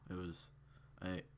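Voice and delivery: plain reading voice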